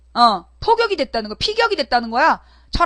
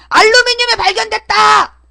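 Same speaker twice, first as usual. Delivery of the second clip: much higher and louder, hammed-up proclamation; mock announcement shout; 7 semitones higher, 5 dB louder than usual. Host mocking the government finding, hammed-up shout. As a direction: trumpet it like a breaking bulletin.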